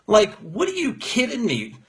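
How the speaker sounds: ordinary delivery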